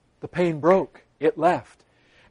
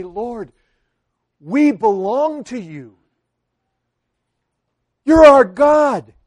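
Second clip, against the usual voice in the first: earnest prayer; 3 semitones higher, 6 dB louder, slower than usual. Acted. Acted prayer to the Lord.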